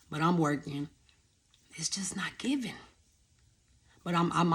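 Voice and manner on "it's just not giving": a soft whispered aside. Real, not acted. Telling viewers the job isn't paying, whispered aside. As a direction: breathe it out as a resigned whisper. Money not enough despite working.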